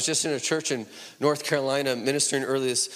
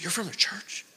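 acting out a hushed, higher-pitched astonished question; retold breathy-whispered amazement; hushed disbelief; 6 semitones higher, 3 dB quieter than usual. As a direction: half-whisper it as someone who can't believe it. Retold woman's astonished question to visitors.

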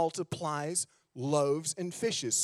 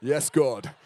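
put-on louder Australian-accented prayer cry; 2 semitones lower, 8 dB louder, slower than usual. Pastor joking about praying in an Australian accent, louder. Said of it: "we'll just go Australian".

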